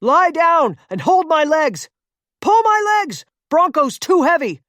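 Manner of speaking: desperate commands, yelled loud; urgent shouting. Acted. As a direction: shout orders in a panic. Rescue on cracking ice.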